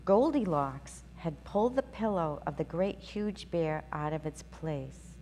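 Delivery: ordinary delivery, nothing marked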